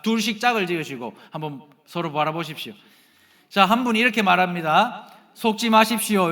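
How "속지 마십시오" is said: firm, louder admonition, falling at the end; a firm, loud word of caution; firm warning demo. Shown as a demonstration. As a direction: say it as a clear warning to your partner. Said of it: "이렇게 말하세요".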